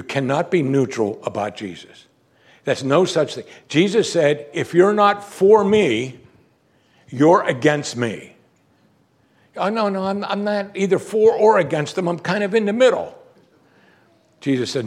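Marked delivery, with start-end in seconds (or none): 4.5-8.3 slow, loud, weighty warning; firm ultimatum. Acted. Acting Jesus's confrontational demand. 9.6-13.2 high-pitched protesting waffler; hammed-up high voice making excuses; mock objector. Acting a noncommittal objector.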